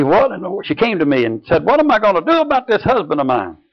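high, fed-up voice of a nagging wife; exasperated wife. Retold or imitated. Wife complaining to the preacher about her husband, in a high mock whine. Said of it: "she said to me".

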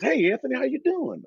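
lightly voiced boss, pitched high; a casual hello from the big boss; owner's friendly greeting. Owner greets the new player, lightly voiced friendly boss.

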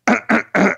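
repeated mock throat-clearing. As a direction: clear the throat like a stalling speaker. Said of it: "a public speaker just going".

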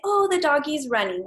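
the right way, in a cooing parent voice; proper grammar baby talk. Shown as a demonstration. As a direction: brighten up for the toddler, grammar intact. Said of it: "I would say".

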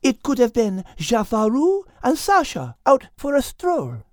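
high French cat voice, arguing; French-accented cat reasoning; Liz, French accent. Acted. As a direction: counter him calmly in French accent. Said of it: "Liz argued".

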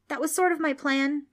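put-on high-pitched boyish confession; sheepish admission. A narrator's reading. Preston admits his plan to Trix, sheepishly. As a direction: own up to it awkwardly. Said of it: "Preston admitted".

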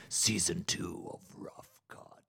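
breathy whispered announcer tag; clean whisper. Shown as a demonstration. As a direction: whisper the show title cleanly. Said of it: "let me give you a clean one".

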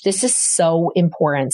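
ordinary delivery

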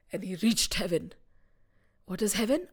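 usual speaking voice

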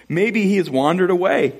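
high-pitched teasing speculation; Elijah mocking. Prophet mocking a god who does not answer, high teasing. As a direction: pile up mock excuses in a high voice. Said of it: "Elijah mocked them".

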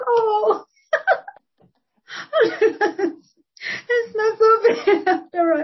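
retold pleased surprise, laughed through; laughing relief. Podcaster recalling praise from the moderator, laughing relief.

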